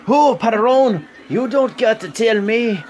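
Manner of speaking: bad Mexican accent impression; terrible Mexican accent. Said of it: "I know it's a terrible Mexican accent".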